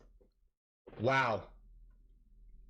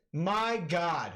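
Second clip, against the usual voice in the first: usual speaking voice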